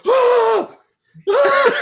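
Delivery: loud high-pitched scream, laughing after; reenacted yell. Retelling yelling while driving home, loud yell. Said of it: "all of a sudden I started yelling".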